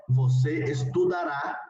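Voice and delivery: você estudará, slow and deliberate, each syllable clear; teacher's careful Portuguese model; slow model pronunciation. Shown as a demonstration. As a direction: say você estudará slowly for students to copy. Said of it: "la conjugación en futuro sería".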